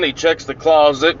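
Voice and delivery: ordinary talk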